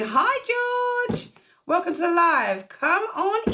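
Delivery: high, slow, sing-song hello stretched out; sing-song greeting. Real, not acted. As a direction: greet her like a long-lost friend, way up high. Greeting a viewer joining live.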